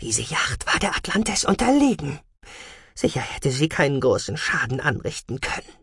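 high-voiced indignation; protest, almost offended, pitch up; indignant. A narrator's reading. Scientist defends herself before commander Cassian, indignant. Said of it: "Die Wissenschaftlerin klang empört, beinahe beleidigt".

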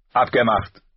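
eager shouted-back agreement; calling back. Acted. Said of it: "rief Robb zurück".

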